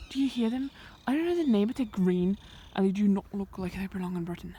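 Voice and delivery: ordinary talk, nothing marked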